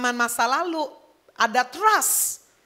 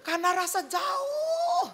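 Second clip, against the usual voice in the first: high, drawn-out call; long wailing cry into the distance; mock shouted prayer; 14 semitones higher, slower than usual. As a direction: call out as if God were far away. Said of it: "kalau doa sampai teriak-teriak".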